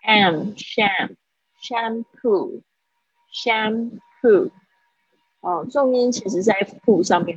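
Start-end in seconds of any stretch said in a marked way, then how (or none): 0.1-4.5 very slow "sham, sham, shampoo" with a full sh sound, not s; model pronunciation. Shown as a demonstration. Teacher drills "shampoo" for students, very slow and deliberate. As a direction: drag out the "sh" in "sham" very slowly, then say "shampoo". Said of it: "这个S H的声音哦，我不要念成西的声音".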